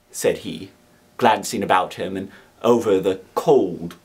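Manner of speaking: plain reading voice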